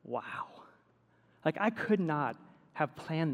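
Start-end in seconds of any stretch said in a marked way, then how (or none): 0.0-0.5 soft breathless whisper; awed whisper. Retold or imitated. Youth pastor hearing the boy's family trouble, awed whisper. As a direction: barely breathe it out in awe. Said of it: "And I was like".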